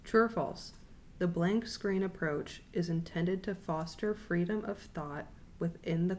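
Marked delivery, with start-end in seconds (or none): 0.0-0.7 True or false? repeated higher and louder; asked again, louder. Shown as a demonstration. Teacher repeats the quiz question to her class, higher and louder. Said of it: "I'll say it again".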